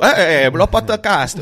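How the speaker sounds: slow, high, loud hammed-up Italian accent; a deliberately terrible Italian accent, drawn out; bad Italian accent. Host announcing the podcast in a bad Italian accent. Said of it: "je le fais très, très mal, l'accent italien".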